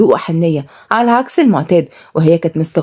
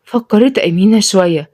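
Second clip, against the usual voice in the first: plain reading voice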